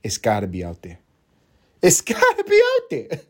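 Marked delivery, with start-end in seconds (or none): 1.8-3.2 high-pitched, cracking up mid-line; squealed out with a laugh; laughing insistence. Real, not acted. Laughs while repeating own line.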